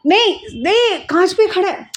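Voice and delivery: high, sudden alarmed cry. Real, not acted. Child standing on glass. (बच्चा कांच पर खड़ा है, घबराकर रोकना)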